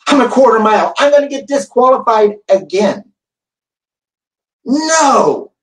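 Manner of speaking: a racer's panic as the coach closes in; panicked inner cry. Retold or imitated. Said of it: "And all I can think was".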